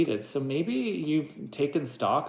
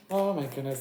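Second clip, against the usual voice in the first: ordinary delivery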